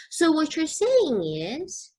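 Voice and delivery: slow, high, rising on saying; slow rising model phrase. Shown as a demonstration. Teacher modeling a summarizing opener, slow and rising. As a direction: lift the word saying into a gentle rise. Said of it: "Begin with".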